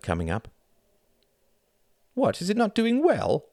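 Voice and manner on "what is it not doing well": put-on very high, questioning child voice; boy character. A narrator's reading. Narrator voices boy in story.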